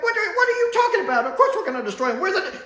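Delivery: very high, fast, shouted Dalek squawk with laughter breaking in; offended Dalek losing it; outraged Dalek. Retold or imitated. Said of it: "he gets all mantic and, and Dalek-y".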